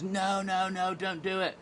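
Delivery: high, urgent mock plea; alarmed plea. Acted warning to [name] in sketch.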